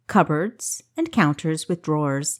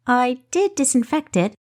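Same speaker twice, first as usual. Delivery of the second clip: higher, defensive protest; 7 semitones higher than usual. Acted. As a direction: insist you did it right. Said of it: "Kessa protested".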